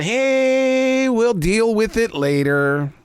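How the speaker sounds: long drawn-out, sing-out "hey", then slow; imitating a put-it-off shrug; casual brush-off. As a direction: brush it off lazily, stretching the words. Quote of people putting things off.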